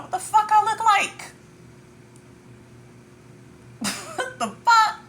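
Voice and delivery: laughing high-pitched outrage; incredulous squeal. Real, not acted. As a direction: shriek it like it's absurd. Mock outrage at competing.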